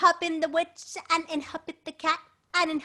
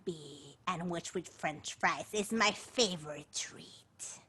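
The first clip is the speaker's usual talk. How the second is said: low, slow menacing dragon; 6 semitones lower, slower than usual. Acted. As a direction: growl it like a hungry dragon. The dragon threatens the witch.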